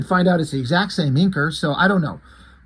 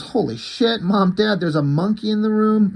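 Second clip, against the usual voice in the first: mock yell, pitched higher; retold scream; 7 semitones higher, 3 dB louder than usual. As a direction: imitate a panicked kid calling for Mom and Dad, half-shouted. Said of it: "Kid screams".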